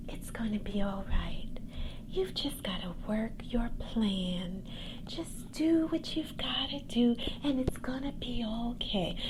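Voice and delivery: soft breathy whisper of reassurance; quiet soothing voice. Retold or imitated. Showing listeners the gentle voice some people need, whispered softly. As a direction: hush it like calming a friend. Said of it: "I just need a quiet voice".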